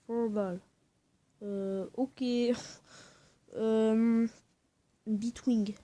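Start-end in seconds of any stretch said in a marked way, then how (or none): none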